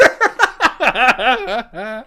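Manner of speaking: put-on high cackling child laugh; cackling kid laugh; mocking laugh. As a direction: laugh harder just to spite him. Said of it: "makes it a point to laugh even harder".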